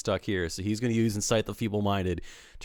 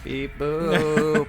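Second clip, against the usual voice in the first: robot voice; 6 semitones higher, 4 dB louder than usual. Acted. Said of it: "his best and strongest beep boop".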